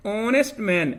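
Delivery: ऑनेस्ट said with no h, starting on the vowel; silent h. Shown as a demonstration. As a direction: say ऑनेस्ट as "onest", dropping the h. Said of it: "ये हॉनेस्ट नहीं है".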